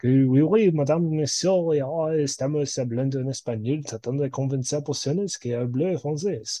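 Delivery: hammed-up French accent sliding into Spanish; jokey French-accented babble; fake French accent. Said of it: "I can do the accent".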